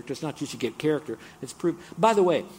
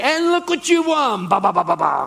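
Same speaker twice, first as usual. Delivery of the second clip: game show host; 9 semitones higher, 10 dB louder than usual. Retold or imitated. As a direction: sell the prize like a TV host. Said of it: "and they say".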